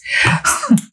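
amused little laugh before giving his name; high airy laugh. A narrator's reading. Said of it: "He laughs and says".

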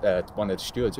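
normal talking voice

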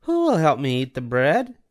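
a hen politely asking a neighbour; Little Red Hen. A narrator's reading. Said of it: "said the Little Red Hen".

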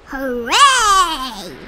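loud triumphant cheer; shouted. A narrator's reading. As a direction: cheer it from the top. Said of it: "shouted Yertle".

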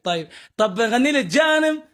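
normal talking voice

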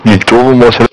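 radio-filtered pilot voice; over a cockpit radio; pilot cockpit headset. Real, not acted. Pilot radio check with tower.

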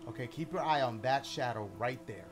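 usual speaking voice

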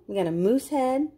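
ordinary delivery, nothing marked

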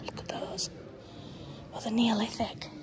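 soft hushed whisper. Real, not acted. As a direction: whisper it so nobody hears. Hushed remark in a museum.

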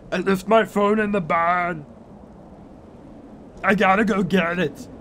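hammed-up Austrian accent; put-on action-hero voice, mock urgency; Arnold Schwarzenegger. Farmer who left his phone in the barn, mock Austrian accent. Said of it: "Am I still Arnold Schwarzenegger".

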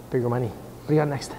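usual speaking voice

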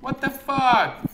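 high, loud, drawn-out outburst; frustrated yell. Real, not acted. Gamer baffled by the game, loud high yell. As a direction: yell it, stretching the last word.

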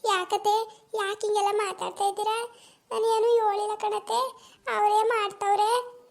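1 timid daughter-in-law. Acted. Daughter-in-law answering scolding mother-in-law.

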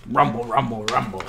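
low, soft rumbling growl; tummy rumble. Acted. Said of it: "he heard a faint growl".

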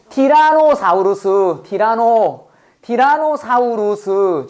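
very slow, stretched-out dinosaur name; drawn-out name. Shown as a demonstration. Teacher naming the scariest carnivore, drawn out. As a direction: stretch every syllable of the name.

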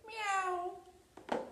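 high, soft playful meow; cat meow. Pretend. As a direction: mew like a little cat for kids. Imitating a neighborhood cat.

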